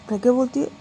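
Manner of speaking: ordinary delivery, nothing marked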